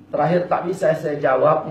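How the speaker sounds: normal talking voice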